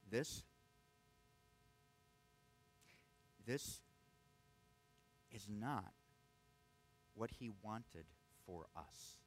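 soft, slow, with long pauses between words; slow deliberate emphasis. Shown as a demonstration. Pastor letting a hard truth sink in, slow and soft. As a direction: let each word land, then drop to almost nothing. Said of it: "I want that to sink in".

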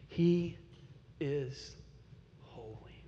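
word by word, fading almost to a whisper; awed, very quiet close; reverent hush. Real, not acted. Climax on God's holiness.

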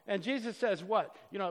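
ordinary talk, nothing marked

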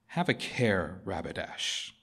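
soft, low, grave warning; quiet warning. A narrator's reading. Aslan quietly warning the prince, low and soft. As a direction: hush it, calm and deadly. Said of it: "said Aslan quietly".

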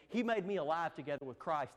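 ordinary talk

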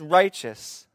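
slow, loud, firm pronouncement; a preacher voicing God as judge, slow and emphatic; judge's verdict. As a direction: declare it like a judge banging the gavel.

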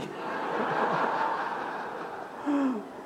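unvoiced falling laugh; breathy laugh. Real, not acted. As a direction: laugh silently at yourself. Laughing at the memory.

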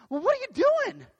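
very high, loud, incredulous question; hammed-up astonished psychiatrist; baffled questioning. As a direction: squeak it out in mock bewilderment.

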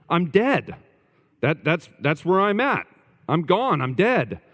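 high-pitched, matter-of-fact claim; lightly voiced man who is sure he's dead; a hint of a deluded patient; calm dead man. Man tells psychiatrist he's dead.

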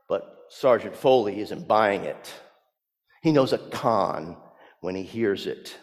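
ordinary talk

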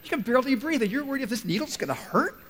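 high, loud disbelief; you can't be serious, man; incredulous inner voice. Retold or imitated. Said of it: "I'm thinking to myself".

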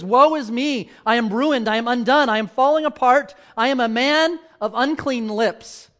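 loud, high, desperate confession; Isaiah's anguished cry. Acted. Isaiah on the ground before God, crying out loud in anguish.

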